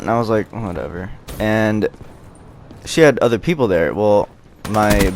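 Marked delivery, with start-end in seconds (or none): 0.5-1.1 soft, offhand retold brush-off; low-key, indifferent retold reply; dismissive. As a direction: toss it away quietly. His dismissive reply to hers.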